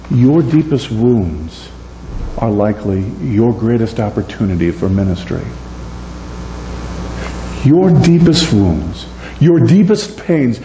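ordinary talk, nothing marked

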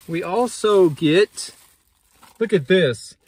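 loud, high mock excitement; slow, overblown showcase with sarcastic awe; sarcastic hype. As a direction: present cheap party supplies like treasures. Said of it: "The sarcasm, I hope, is not lost".

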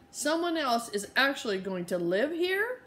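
high anxious question; worried. Acted. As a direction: ask it in a small rising worry. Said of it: "asked Hyacinth worriedly".

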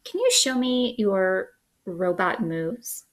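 usual speaking voice

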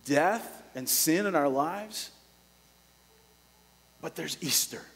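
ordinary talk

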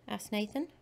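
plain reading voice

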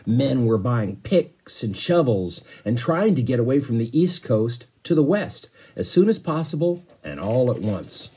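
put-on read-aloud storytelling voice for kids; teacher reading a chapter book to the class; story narration. A narrator's reading. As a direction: paint the voyage for young listeners.

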